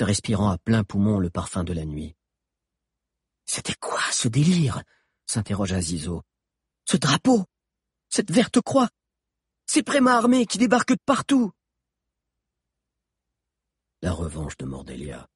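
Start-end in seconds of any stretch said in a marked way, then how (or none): 3.5-11.5 high-pitched, agitated questions; bewildered questioning. Acted. Zizo asking about the madness they fled, bewildered. Bewildered fugitive demands explanations. 14.0-15.3 low, hushed, solemn answer; grave voice. A narrator's reading. Hakan answering his friends about the revenge, grave low voice. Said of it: "répondit Hakan d'une voix grave".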